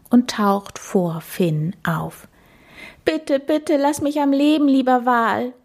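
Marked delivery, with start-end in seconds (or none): 3.1-5.5 high, pleading cry for mercy; pleading scream. A narrator's reading. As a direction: beg the whale for life, pitched up high. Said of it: "schreit Finn". (hohes, flehendes Schreien)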